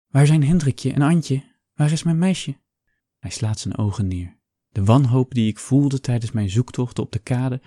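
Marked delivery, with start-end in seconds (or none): 0.1-2.6 anxious plea. A narrator's reading. Frantic mother asking for child.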